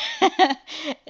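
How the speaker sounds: high, loud burst of laughter; amused laugh. Real, not acted. Aunt amused at her niece owning it, laughing loudly.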